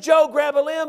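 loud, high urgent order; urgent holler. Retold or imitated. Hanging hunter tells buddy to grab, urgent holler. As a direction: holler it at a man about to fall.

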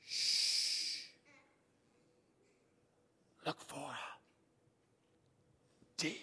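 long shush, then slow whispered words; whispering. Retold or imitated. Buddy shushes his friend to hunt on, whispering. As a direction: hush him, then whisper with long pauses. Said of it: "He's whispering".

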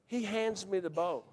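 plain reading voice

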